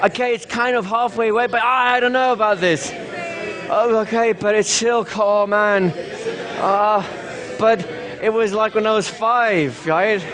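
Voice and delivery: high, anxious pretend subject; mock freak-out. Pretend subject telling the hypnotist it's still there, mock freak-out. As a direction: play a subject who can't shake it off. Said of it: "what if I'm really freaking out".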